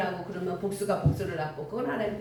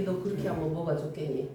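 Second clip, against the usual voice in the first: lower, soft fatherly question; a gentle God chiding a young preacher; God asking softly; 6 semitones lower, 4 dB quieter than usual. Retold or imitated. God softly asking the angry young preacher. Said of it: "그러면 또 하나님이".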